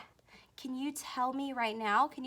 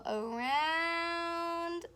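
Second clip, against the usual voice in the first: slow drawn-out stroke call; 5 semitones higher than usual. Shown as a demonstration. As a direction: stretch out around slowly, higher. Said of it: "teacher models letter stroke".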